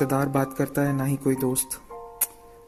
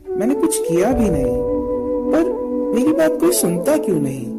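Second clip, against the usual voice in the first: anguished protest; 5 semitones higher, 4 dB louder than usual. Acted. Wrongly accused man alone in his despair, anguished protest. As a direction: pitch climbing in desperate lament.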